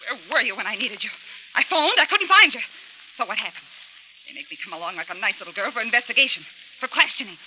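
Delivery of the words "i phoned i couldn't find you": higher, louder accusing; indignant complaint. Acted. Linda to her lawyer, indignant and loud. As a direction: scold him for not being there.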